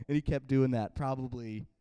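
ordinary talk, nothing marked

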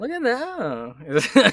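changed inflection. Retold or imitated. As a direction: stretch it out, high and laughing. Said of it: "inflection changes".

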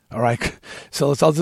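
ordinary delivery, nothing marked

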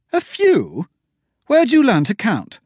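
very high, loud scoff; mock his count; high disbelieving jab; incredulous sarcasm. A narrator's reading. Mocking Royce's count.

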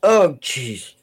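sighing through a hiccup. Real, not acted. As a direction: hiccup interrupts the words.

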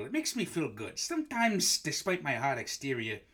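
ordinary talk

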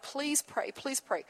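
hushed retold begging; soft pleading. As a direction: imitate a distraught man's quiet plea. Retells crying man begging prayer.

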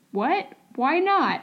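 high, incredulous questioning; mock disbelief. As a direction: feign being baffled by a refusal. Mock disbelief at reluctant guests.

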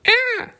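high startled shriek. Acted. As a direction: let out a sudden high squeal. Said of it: "They s-, shrieked".

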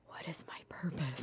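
breathy whisper of doubt; soft unvoiced whisper. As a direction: whisper it as a lost inner question. Whispered inner question.

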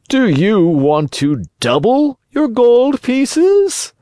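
sly fox making a tempting offer. A narrator's reading. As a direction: lure them in with a high drawl. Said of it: "Suddenly the fox stopped in his tracks".

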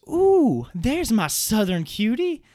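high, loud imitation of a cooing woman; gushing bus driver. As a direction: imitate her drawn-out, sing-out flirty greeting, loud and high. Bus driver's gushing greeting, acted.

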